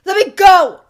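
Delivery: very loud yell of rage; raging shout. A narrator's reading. Tied-up son raging at his mother, shouting. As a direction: scream to be let out. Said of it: "thrashed around in his rather easily containable rage".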